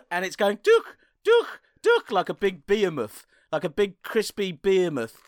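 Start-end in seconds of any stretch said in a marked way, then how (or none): 0.6-2.1 very high, loud squawked sound effect; high squeaky comic noise. Pretend. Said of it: "the new Michael Winslow".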